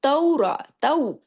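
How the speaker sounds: अक़ामुत तौरा chanted in tarteel, with a full heavy क़ा; Quranic recitation. Shown as a demonstration. Teacher reciting a verse for her students, melodic recitation. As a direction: recite it like tajweed class. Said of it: "अब देखिए".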